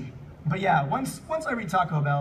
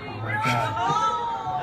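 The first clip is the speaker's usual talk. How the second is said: much higher, dragged-out shocked cry; shocked oh my God; 13 semitones higher, much slower than usual. Real, not acted. Host recalling a shocking fanfic, slow disbelieving cry. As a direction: drag it out in disbelief. Host reacting to Game Grumps fic.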